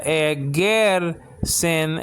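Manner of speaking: "egersin" very slowly, syllable by syllable; slow teaching pronunciation of "egersin"; drawn-out pronunciation. Shown as a demonstration. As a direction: stretch out "egersin" for learners. Teacher sounds out the word.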